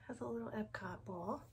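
soft whispered aside; whispering. Real, not acted. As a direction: whisper it to yourself. Aside while showing a t-shirt.

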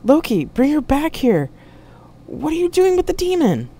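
high, loud, urgent demand; alarmed shout. A narrator's reading. Jack yelling at Loki to bring her back, urgent shout.

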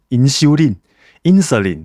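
"insulin" as in-shiu-lin, then in-se-lin; teacher's insulin pronunciations. Retold or imitated. Recalling biology teachers, two pronunciations of "insulin". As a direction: contrast two ways of saying "insulin". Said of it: "念胰岛素都会念什么".

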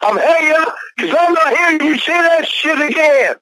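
high, furious outburst; real high-pitched fury; angry screaming. Real, not acted. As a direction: scream it and be done. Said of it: "you were screaming".

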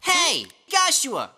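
drawn-out, indignant call; stretched holler to get his attention; confronting call. Acted. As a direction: call him out by name, slowly. Calls out to confront him.

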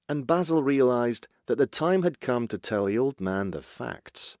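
ordinary delivery

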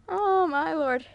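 usual speaking voice

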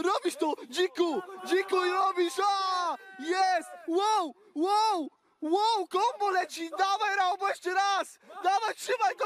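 high-pitched yelled encouragement; hype shouting. Real, not acted. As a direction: yell strength into a struggling lifter. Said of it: "Będę krzyczał, żebyś to wyciągnął".

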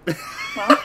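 ordinary delivery

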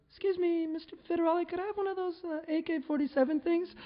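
very high, polite falsetto; mock female voice. Woman asks the federale for a gun, mock female falsetto. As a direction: imitate a woman asking in falsetto.

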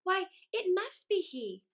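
plain reading voice

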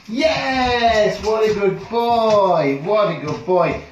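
high loud yes, very slow praise; joyful shouted praise. Real, not acted. Trainer celebrating the dog's spin on the word alone, joyful praise.

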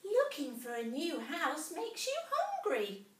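a tiny mouse gasping in wonder; little mouse voice. A narrator's reading.

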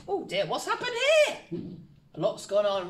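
mock surprise. Mock shock at revealed answers.

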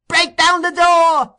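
very high, loud, stretched-out scream; child screaming to the mob outside; boy's scream. A narrator's reading. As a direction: scream it slowly at full force. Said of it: "screamed the boy".

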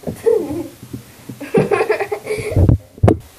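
long, high laughing fit; helpless laughter. Real, not acted. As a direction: burst out laughing. Laugh continues.

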